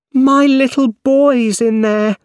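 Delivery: high, loud and slow, a desperate scream. A narrator's reading. Mother screaming for her boy in the fire, high and loud. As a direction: scream it up at the burning building. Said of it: "a woman screamed".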